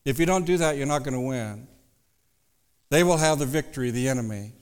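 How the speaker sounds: normal talking voice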